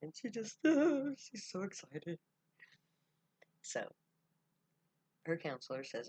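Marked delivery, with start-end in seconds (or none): 0.6-1.1 high, loud burst of laughter; giddy squealing laugh. Acted. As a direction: laugh out with pure joy. Girl overjoyed on phone.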